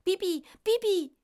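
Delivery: high, loud squawking bird voice calling Bibi; piercing high bird cry for its owner; starling calling out. Acted. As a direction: shriek it like a talking starling. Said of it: "خانمچه داد زد".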